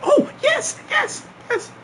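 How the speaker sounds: very high, squealing imitation of her yes; mock excited yes. Girl accepting the homecoming invite, squealing excited yes. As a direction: squeal it like she's overjoyed.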